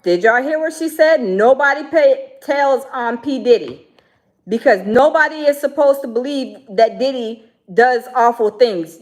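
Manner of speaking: loud and indignant, pressing the point hard; outraged loud. Real, not acted. Vlogger reacting to the clip for viewers, loud and indignant.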